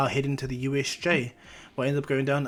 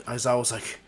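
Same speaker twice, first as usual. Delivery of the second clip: usual speaking voice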